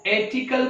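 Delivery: "एटिकल" with the letter-name A as first vowel; mispronunciation. Shown as a demonstration. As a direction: say "एटिकल" opening on "ay", no r. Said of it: "क्या आर्टिकल का उच्चारण".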